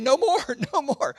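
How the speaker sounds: high, loud laughing protest. Retold or imitated. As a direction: wave off the extra gifts with a laugh. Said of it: "I kept saying".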